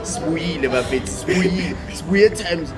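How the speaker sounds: ordinary talk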